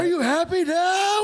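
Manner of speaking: high, slow wounded drama voice; mock melodrama. Owner wailing at the flattened cat, high mock melodrama. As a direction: ham up a betrayed lover.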